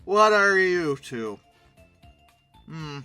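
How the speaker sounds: usual speaking voice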